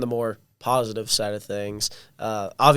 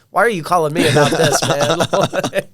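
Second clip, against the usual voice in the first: laughing through a baffled question; laughing disbelief; 4 semitones higher, 7 dB louder than usual. Retold or imitated. Friend recalls the odd phone call, laughing. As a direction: ask it while cracking up. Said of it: "I'm like".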